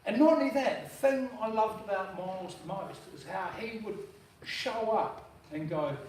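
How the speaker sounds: usual speaking voice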